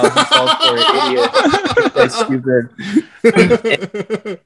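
high laughing voice; laughing at trolls mocking him; laughing through it. Real, not acted. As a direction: quote them while cracking up. Laughing while quoting comments.

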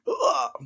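short high-pitched "ugh"; groan of dread. Retold or imitated. Aunt dreading a whole other level, high groan. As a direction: recoil with a squeamish groan. Retold dread of reading aloud.

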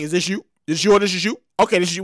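low questioning bank voice; bank asking. Retold or imitated. Bank checking your card, low questioning voice. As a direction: ask it like the fraud desk. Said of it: "it was like".

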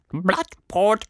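high creature voice talking through a mouthful; mouth full. Acted. Caterpillar answering Tilda while chewing, high with mouth full. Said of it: "sagte die Stimme mit vollem Mund".

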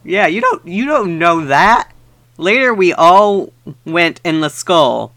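ordinary delivery

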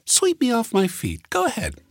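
very high mocking woman's voice; sarcastic dare. A narrator's reading. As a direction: goad him on with a sneer. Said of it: "she said sarcastically".

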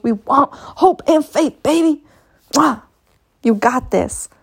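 rally them like a TED talk; motivational speaker. Real, not acted. To her listeners, preaching hope and faith, motivational speaker. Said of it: "I should title this motivational speaker".

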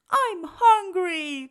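slow, loud pleading cry. Acted. Cyril cries to Bruce he's hungry. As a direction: cry it out slowly. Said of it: "cried Cyril".

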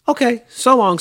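high, loud cheery goodbye; cheerful. A narrator's reading. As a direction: call a bright farewell in a high voice. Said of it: "as she cheerfully".

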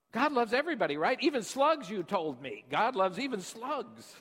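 put-on child's excited insistence. Daughter telling her father God loves even slugs, high and excited. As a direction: insist eagerly like a small child. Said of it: "she says".

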